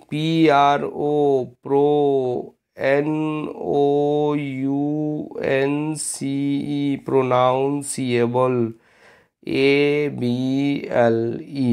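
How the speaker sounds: drawn-out letters, each one set apart; slow spelling dictation. Shown as a demonstration. Teacher spelling pronounceable for exam students, very slow. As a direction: spell it slowly so they catch every letter. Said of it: "spells pronounceable".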